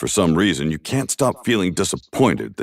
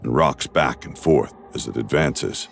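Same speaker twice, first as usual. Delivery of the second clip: ordinary talk, nothing marked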